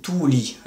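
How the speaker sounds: "tuuli" with the u held long. Shown as a demonstration. Teacher contrasting Finnish vowel lengths, long u in "tuuli". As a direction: stretch the u in "tuuli", keep the l short. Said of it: "avec un U long".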